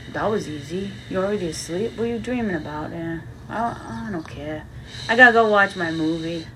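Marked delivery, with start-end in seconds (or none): none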